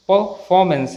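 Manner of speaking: परफॉर्मेंस as "puh-faw-mence"; silent r. Shown as a demonstration. Teacher gives correct pronunciation, r silent. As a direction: drop the r in परफॉर्मेंस. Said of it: "सही pronunciation".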